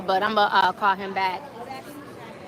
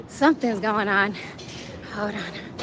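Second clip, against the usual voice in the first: winded after running; panting between words after a run; out of breath; 6 dB quieter than usual. Real, not acted. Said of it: "Now I'm out of breath".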